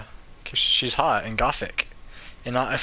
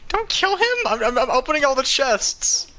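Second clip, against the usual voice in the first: panicked shout; 11 semitones higher than usual. Real, not acted.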